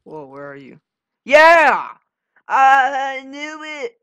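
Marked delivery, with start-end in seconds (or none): 1.2-4.0 high, loud cheer, drawn out; yelling in victory; triumphant yell. Real, not acted. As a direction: shout it in triumph. Celebrating a win in game.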